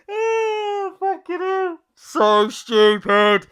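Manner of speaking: high, loud laughter through the words; laughing hard. Real, not acted. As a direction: crack up at your own joke. Laughing at his own joke.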